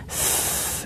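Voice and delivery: breathy flowing s hiss; long hiss. Shown as a demonstration. Teacher showing the flowing s, a long hiss. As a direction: let the s stream out. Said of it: "ngalir ya".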